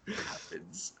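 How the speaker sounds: hushed, slow whisper of the big moment; slow awed whisper; dramatic whisper. Acted. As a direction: whisper it like a secret reveal. Echoed dramatic reveal.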